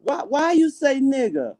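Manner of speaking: high-pitched imitation of puzzled coworker; high, drawn-out questioning voice; Indonesian coworker. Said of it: "she came to me, said".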